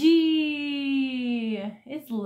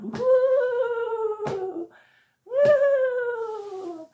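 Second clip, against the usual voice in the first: excited whoop; 10 semitones higher than usual. Acted. Elephant trumpet cheer.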